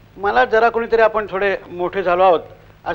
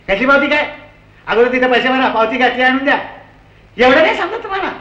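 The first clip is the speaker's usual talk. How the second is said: yelling clerk; 6 semitones higher than usual. Retold or imitated.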